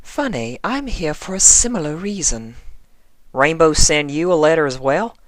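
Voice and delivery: high, gracious inquiry; sweet and courteous, a touch of charm; Rarity politely greeting the young constable; polite refined lady. Acted. Rarity voiced in conversation.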